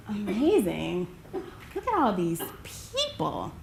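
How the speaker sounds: slow, drawn-out wonder; slow amazed murmur to yourself; acted awe. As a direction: take in a huge crowd, awestruck. Acts out awe at the stadium.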